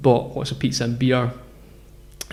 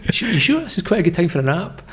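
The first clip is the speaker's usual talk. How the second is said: high, mock-polite persuasion; polite pestering; 6 semitones higher than usual. Traveller pushing a nap product on a stranger, politely pestering. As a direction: keep coaxing politely in a raised pitch. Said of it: "really politely harassing this guy".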